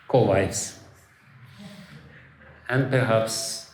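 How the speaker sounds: ordinary delivery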